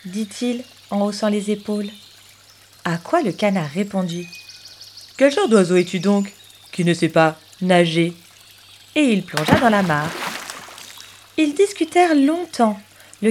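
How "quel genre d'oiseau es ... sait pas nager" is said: lower, odd-timbred duck voice; the duck shoots the question back, lower; duck's retort. Acted. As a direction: answer back as a grumpy duck.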